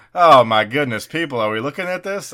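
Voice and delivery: very loud, high mock amazement; hammed-up host appealing to the crowd; astonished game show host. Host's mock astonishment at the score.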